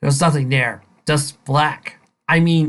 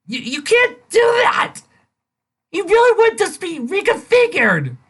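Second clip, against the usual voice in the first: outraged shout; 4 dB louder than usual. Real, not acted. Reviewer yelling at the fanfic writer in outrage. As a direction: yell it at the author.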